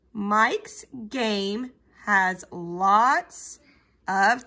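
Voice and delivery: slow, deliberate word-by-word reading; measured beginner-reader pace, pausing between words; slow read-along. Shown as a demonstration. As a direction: read slowly so children can sound out each word. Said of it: "read them a little bit slowly".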